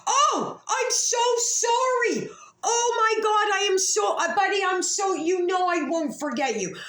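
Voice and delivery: real sobbing, high and loud; crying. Real, not acted. To her friend who died, sobbing out loud apologies. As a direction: break down crying to your lost friend.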